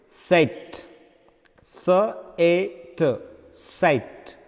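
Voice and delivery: set, then its three sounds one by one, then set; "set" broken into separate sounds and rejoined; sounding out set. Shown as a demonstration. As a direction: spell out set sound by sound, slowly. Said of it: "this is pronounced like A".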